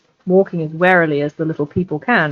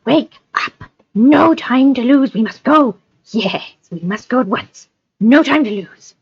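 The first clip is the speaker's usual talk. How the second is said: high, pressing creature voice; urgent Gollum; 6 semitones higher than usual. Acted. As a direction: hurry them along like Gollum. Gollum urging hobbits to go.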